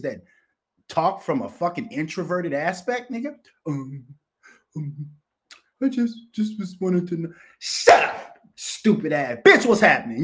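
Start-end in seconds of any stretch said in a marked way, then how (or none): 3.7-7.3 timid mock. 7.6-9.4 snapped. Acted. Answering the mocked timid voice. 9.4-10.2 new slogan. Shown as a demonstration. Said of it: "That's my new thing".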